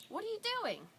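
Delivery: very high imitation of a small child; nosy three-year-old. Three-year-old questioning a woman on a bench, high child voice. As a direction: pipe it up like an unshy kid. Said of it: "my three-year-old, who is far from shy".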